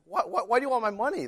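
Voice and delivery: high, put-on whiny voice of a stingy kid; indignant kid cousin. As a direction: squeal it like a kid guarding his cash. Cousin refuses to lend money.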